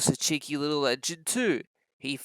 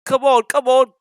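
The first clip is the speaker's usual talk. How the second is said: puffing engine; 7 semitones higher, 8 dB louder than usual. Acted. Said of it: "puffed Gordon to the coaches".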